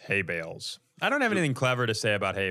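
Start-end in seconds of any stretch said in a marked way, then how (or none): 0.1-0.8 low, fast put-on voice; deadpan low mock voice. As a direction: rattle it off in a low goofy voice. Comic bit on co-host name.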